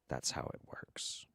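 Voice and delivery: breathy whisper tossed off to the side; a secret little whisper of the rule; whispered aside. Real, not acted. Host teasing absent fans, whispered aside. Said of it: "whispered aside".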